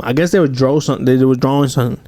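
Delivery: ordinary talk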